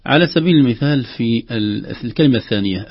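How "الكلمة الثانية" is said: normal talking voice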